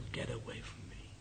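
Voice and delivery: barely voiced whispered refusal; retold whisper. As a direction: breathe it out weakly. Dying man keeps rejecting him.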